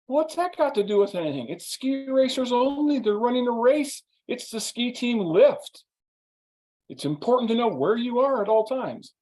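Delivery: imitating a prickly friend defending himself; huffy lecture. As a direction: lecture as if he were right all along. Friend lecturing back indignantly.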